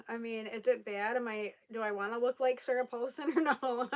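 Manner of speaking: normal talking voice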